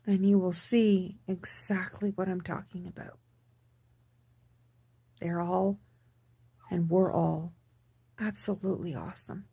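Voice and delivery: soft whispered aside to viewers. Real, not acted. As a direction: breathe it out, barely voiced.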